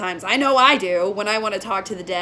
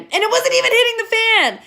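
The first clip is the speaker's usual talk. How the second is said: high, loud indignation; worked-up disbelief; exasperated outburst; 11 semitones higher, 5 dB louder, faster than usual. Real, not acted. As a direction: blurt it out in high-pitched protest. Exasperated correction of self.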